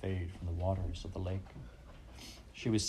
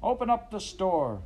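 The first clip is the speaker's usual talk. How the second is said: shout; 12 semitones higher, 4 dB louder than usual. A narrator's reading. Mob demands store opened.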